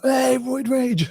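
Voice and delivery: high, slow teasing sing-song; drawn-out mocking jeer at a mate; stretched high taunt from the rugby lads. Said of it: "They're like".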